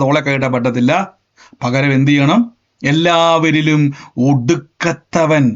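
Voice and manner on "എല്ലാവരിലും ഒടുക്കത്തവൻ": slow emphasis. Acted. Preacher stresses the key phrase.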